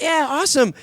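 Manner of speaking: high, loud mock cheer; excited reaction. Friends reacting to his game role, imitated excited approval.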